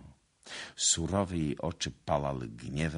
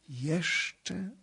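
moaning; 12 semitones higher than usual. A narrator's reading. Lady dreading a further confession, moaning. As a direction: groan the word as a question. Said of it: "jęknęła panna".

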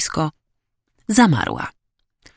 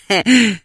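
acted chuckle, pitched up; higher, spluttering student laugh; choking with laughter; 7 semitones higher, 4 dB louder than usual. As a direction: snicker as if choking on it. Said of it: "Mati wręcz krztusił się ze śmiechu".

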